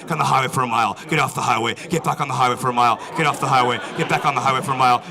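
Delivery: ordinary delivery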